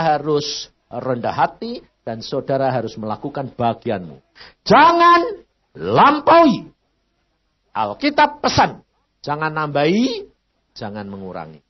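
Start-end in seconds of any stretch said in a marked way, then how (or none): 4.7-6.7 loud, high, drawn-out command; stern slow warning from the pulpit; slow emphatic warning. Real, not acted. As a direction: lay each syllable down slowly and loud. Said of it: "emphatic warning".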